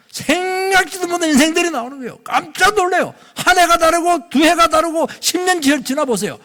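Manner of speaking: high, loud exhortation; rousing preacher's shout; full-force preaching. Real, not acted. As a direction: proclaim it at the top of the register.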